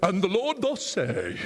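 lightly voiced slow booming Bible reader; a hint of a solemn announcer; mock grand reader voice. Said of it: "a guy with a great voice is gonna go".

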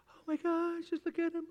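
high hushed cooing; cooing parent. Retold or imitated. New parent gazing at the sleeping baby, cooing. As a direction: coo over the crib in wonder.

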